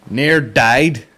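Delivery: emphatic punchline. Real, not acted. Comedian reacting to the woman's words, emphatic outburst. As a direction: stretch it, pause, then hit it hard.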